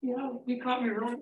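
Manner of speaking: talking through a mouthful of pizza; words round a bite of food; mouth full. Real, not acted. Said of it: "now that you've got a mouthful of pizza".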